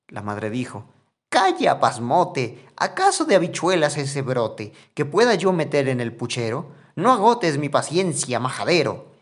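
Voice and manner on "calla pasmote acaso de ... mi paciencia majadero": angry scolding. Acted. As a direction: snap at the foolish boy to shut up. Mother scolds Juan about the beanstalk.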